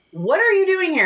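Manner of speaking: high surprised question. Retold or imitated. Retold question to musicians.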